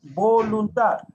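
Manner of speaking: slow, loud "voluntad"; drawn-out key word. Shown as a demonstration. Teacher introduces a key word's meaning.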